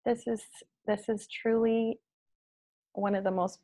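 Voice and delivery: ordinary talk, nothing marked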